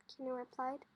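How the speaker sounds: ordinary delivery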